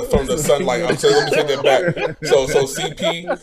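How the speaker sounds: laughing while talking. Real, not acted. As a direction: laugh through the words. Laughs while taking it back.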